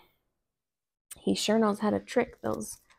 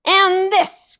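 high loud attacking cry; cried; 11 semitones higher, 8 dB louder than usual. Acted. Rabbit kicks the stuffed rabbit, loud cry. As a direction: yell it as you kick. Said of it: "cried Brer Rabbit".

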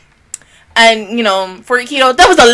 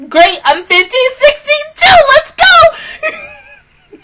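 higher and louder, cracking up into a shout; laughing mock challenge; 4 dB louder, slower than usual. Parent mocking a bully's age, laughing and shouting. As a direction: squeal it out mid-laugh, ready to fight. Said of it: "I'm like".

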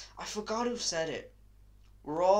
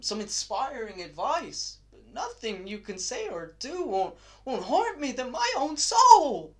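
tormented outburst; 7 semitones higher, 9 dB louder than usual. Acted. Tormented artist telling his friend nothing can hurt him more, building outburst. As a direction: build to a high, loud cry.

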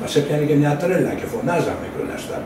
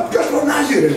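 laughing mock shout; 12 semitones higher than usual. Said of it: "φωνάζα από πίσω".

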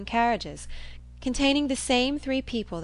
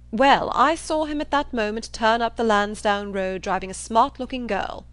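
raised, emphatic assertion; blustering John Thorpe; 3 semitones higher, 6 dB louder than usual. Acted. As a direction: insist with brash certainty. Thorpe reports the sighting.

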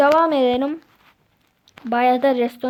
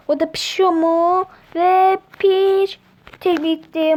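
excited; 6 semitones higher, 7 dB louder, slower than usual. Real, not acted. Excited as cooking finishes.

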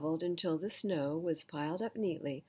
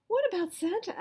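much higher, louder small voice; squeaky Piglet; 14 semitones higher, 6 dB louder, faster than usual. Acted. Piglet nudging Pooh, high squeaky question. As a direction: squeak it anxiously. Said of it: "asked Piglet".

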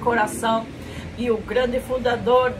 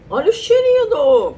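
high, loud delight; excited; 10 semitones higher, 8 dB louder than usual. Real, not acted. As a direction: gush over the smell. Excited sniffing reaction.

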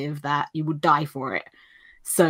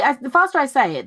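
ordinary talk, nothing marked

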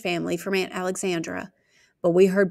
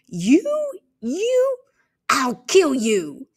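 shrieking rage; 8 semitones higher, 5 dB louder, much slower than usual. Acted. Girl attacking her brother.

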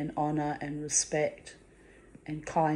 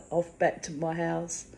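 normal talking voice

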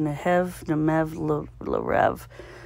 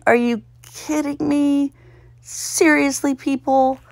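high, incredulous complaint; exasperated; 9 semitones higher than usual. Real, not acted. Solver complaining to the puzzle makers, high and exasperated. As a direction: protest at a ridiculous word.

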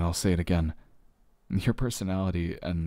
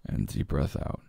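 soft, slow, lower voice guiding the breath out; soft guided exhale; 4 semitones lower, 3 dB quieter, slower than usual. Shown as a demonstration. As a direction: breathe the words out gently like a coach. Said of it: "let's do a little bit of a breath exercise".

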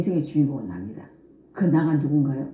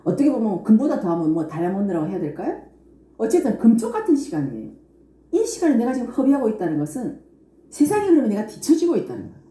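usual speaking voice

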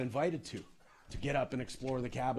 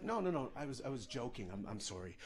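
sheepish apology; 3 semitones lower, 9 dB quieter than usual. Retold or imitated. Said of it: "I was like".

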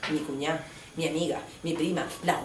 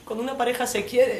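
ordinary delivery

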